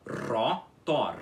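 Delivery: "Rotor" with a rolled first R; pronunciation demo. Shown as a demonstration. Teacher repeating the word for students. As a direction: say "Rotor" with a trilled R at the start. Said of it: "R inicial também é enrolado".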